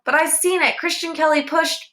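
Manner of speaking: put-on high, eager telling on a boy; insistent tattling. A narrator's reading. Girl telling the teacher what she saw, high and insistent. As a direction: pitch it up, desperate to be believed.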